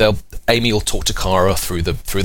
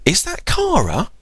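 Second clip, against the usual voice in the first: put-on much higher, slow childlike question; little girl asking; 11 semitones higher, slower than usual. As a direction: ask like an eager toddler. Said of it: "Amy will be like".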